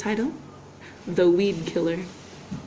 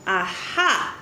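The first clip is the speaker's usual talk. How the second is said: ordinary delivery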